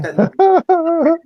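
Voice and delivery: high, slow, laughing squeal. Real, not acted.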